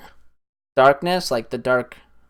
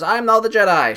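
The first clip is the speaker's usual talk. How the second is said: heroic declaration; 8 semitones higher, 4 dB louder than usual. Retold or imitated. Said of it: "she does".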